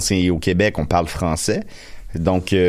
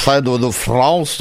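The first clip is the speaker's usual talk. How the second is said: put-on France French accent; 3 semitones higher than usual. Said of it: "je parle le français de France".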